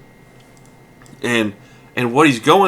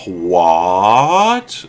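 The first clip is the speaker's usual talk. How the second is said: loud, long rising "what"; mock disbelief; 5 dB louder than usual. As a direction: draw it out in baffled disbelief. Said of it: "lazily watches him and goes".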